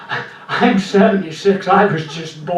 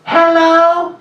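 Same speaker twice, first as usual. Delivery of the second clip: higher squeaky hello on the telephone; high phone voice; 9 semitones higher, 3 dB louder than usual. Shown as a demonstration. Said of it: "my voice goes up high".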